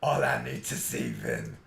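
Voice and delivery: low mock-seductive whisper; mock sultry voice. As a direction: purr it at Vin. Mock-seductive address to Vin.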